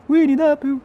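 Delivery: high-pitched exasperated cry. Real, not acted. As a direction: wail the name in disbelief. Outburst at card.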